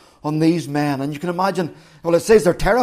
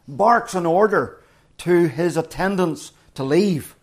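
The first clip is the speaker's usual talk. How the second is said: ordinary talk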